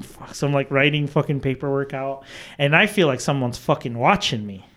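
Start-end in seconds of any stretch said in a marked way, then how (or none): 0.0-0.3 tiny whispered curse; re-enacted, barely audible unease; hushed dread. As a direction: swear under your breath. Retelling finding nothing behind him.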